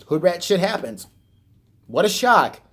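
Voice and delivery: usual speaking voice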